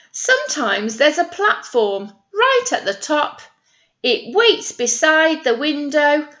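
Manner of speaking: put-on reading to children. Nursery teacher reads the ladder rhyme aloud, sing-song. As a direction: read the verse with wonder for little ones. Reads the rhyming picture book.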